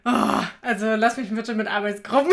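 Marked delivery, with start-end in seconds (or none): none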